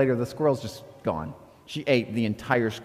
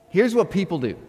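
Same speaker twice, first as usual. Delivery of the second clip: usual speaking voice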